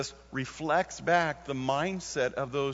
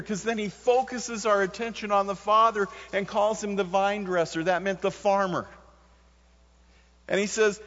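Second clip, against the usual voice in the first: raised, higher, louder preacher voice; preaching at full force; 6 semitones higher, 3 dB louder than usual. Acted. Preacher to his congregation, voicing God and Jesus, preaching at full force. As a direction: drive the sermon home with full force.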